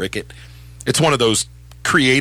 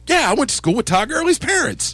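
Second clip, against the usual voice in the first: mock casual reply; 13 semitones higher than usual. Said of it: "I was like".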